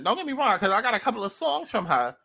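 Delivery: ordinary delivery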